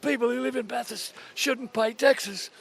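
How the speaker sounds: high, put-on voice of the joking owner; owner's voice. Horse owner joking to the host on the phone, high put-on voice. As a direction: play the sick owner cracking a joke.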